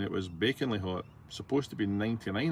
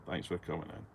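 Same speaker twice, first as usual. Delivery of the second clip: softer muttered echo of the thanks; quiet repeat under the breath; 4 semitones lower, 9 dB quieter than usual. Real, not acted. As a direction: let it drop away as an afterthought. Repeats thanks, second time softer.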